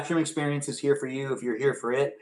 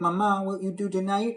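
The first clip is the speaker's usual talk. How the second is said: a hint of a higher child's voice; little kid asking mama; 6 semitones higher than usual. A boy asking his mama about her evening, lightly childlike. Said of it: "And I'd be like".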